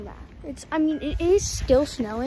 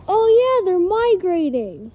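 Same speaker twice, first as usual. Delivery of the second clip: louder and higher, drawn-out migrating; suddenly sure of it, lean on migrating; emphatic realization; 5 semitones higher, 7 dB louder, slower than usual. Real, not acted. Repeats answer louder, with emphasis.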